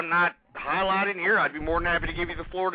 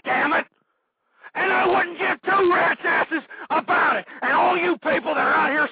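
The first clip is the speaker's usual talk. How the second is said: furious ranting shout, higher and louder; worked up; 10 semitones higher, 3 dB louder than usual. Acted. Radio host raging at liberal callers, furious shout. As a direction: yell it like you've lost your temper. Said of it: "you're getting kinda worked up".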